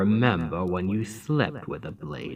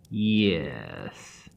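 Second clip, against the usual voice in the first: usual speaking voice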